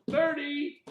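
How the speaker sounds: called out high and loud; loud count. Real, not acted. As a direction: call out the milestone count. Calling out count milestone.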